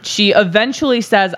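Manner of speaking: ordinary talk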